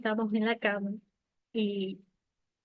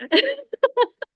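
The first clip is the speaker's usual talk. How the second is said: very high, loud giggling laugh; a squealing laugh of joy; delighted squeal of laughter; 7 dB louder than usual. Real, not acted. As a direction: laugh as if caught out. Mother says it shows.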